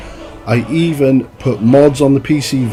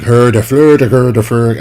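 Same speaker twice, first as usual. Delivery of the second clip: mock Nordic accent, louder; 4 dB louder than usual. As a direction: grunt out the gibberish in a Nordic accent. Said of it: "everybody sounds like Travis fucking Fimmel".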